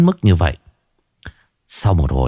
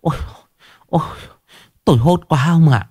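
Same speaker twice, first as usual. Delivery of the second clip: higher, stammering and shaken; terrified after a nightmare; 5 semitones higher, faster than usual. Acted. Wife waking from a nightmare tells her husband, higher, stammering in fright. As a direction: stutter out the fear, voice climbing. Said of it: "chân tay không tự chủ được, đang run lập bập".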